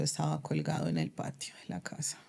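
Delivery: barely voiced, breaking down into a low whisper; a real, hushed whisper as the worst part comes out. Real, not acted. Telling the hosts she found her husband, in a whisper. Revealing finding husband hanged.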